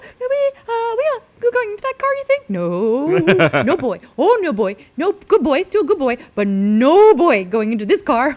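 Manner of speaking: very high, loud, excited dog voice; a dog hoping for a car ride; dog Dwight. Retold or imitated. Dog Dwight begging about the car, high and excited. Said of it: "he'd be upset that we're not on a walk".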